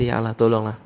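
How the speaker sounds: easy, matter-of-fact request; everyday untroubled delivery; casual comfortable prayer. Acted. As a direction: pray as if nothing is wrong. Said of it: "Tengah senang kita kata".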